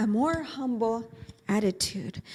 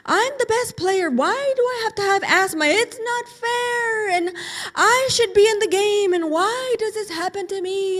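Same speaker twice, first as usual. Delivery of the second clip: much higher and louder, whiny and hurt; it's not fair tantrum; upset whine; 8 semitones higher, 4 dB louder than usual. Acted. Upset boy after losing out.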